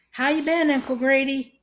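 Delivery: high, loud greeting; hollered question, pitched well up; speaking up. A narrator's reading. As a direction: speak up for a deaf old man. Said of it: "she said, speaking up".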